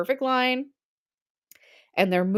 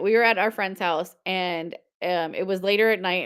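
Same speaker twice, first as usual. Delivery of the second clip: normal talking voice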